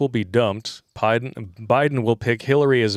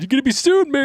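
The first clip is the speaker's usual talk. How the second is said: high, loud comic protest; hammed-up warning to a buddy; mock alarm; 5 dB louder, faster than usual. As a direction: raise the pitch in playful panic. Mock warning about getting banned.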